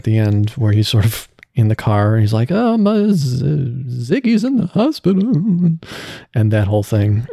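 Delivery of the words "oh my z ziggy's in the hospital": high-pitched, grief-stricken cartoon voice; over-the-top cartoon character acting heartbroken, pitched way up; sad Iggy. Voice actor replaying the scene in the car, high and grief-stricken. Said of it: "how would Iggy be if he's, like, sad".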